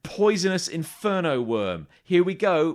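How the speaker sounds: big showy presenter patter, drawing out the key words; hyped video host. Retold or imitated. Comedian mimics a stunt vlogger greeting viewers, loud mock hype.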